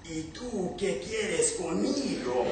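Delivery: a giant woken from his nap, annoyed; grumpy sleepy giant. Acted. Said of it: "and he said".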